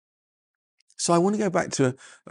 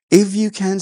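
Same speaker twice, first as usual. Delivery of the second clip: higher, louder echo of the father's words; Jesus, lively; 6 semitones higher, 6 dB louder than usual. Retold or imitated. Jesus to the doubting father, lightly challenging. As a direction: toss the doubt back at him. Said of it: "said Jesus".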